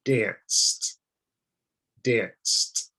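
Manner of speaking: danced with a clear T ending; enunciated ending. Shown as a demonstration. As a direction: stress the T of danced. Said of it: "I'm making sure to enunciate my words".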